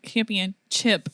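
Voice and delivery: CHAMPIONSHIP drawn out, ending in "chip"; slow, insistent "champion-CHIP" for championship; sassy wrong pronunciation. Retold or imitated. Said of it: "my little sassy four-year-old".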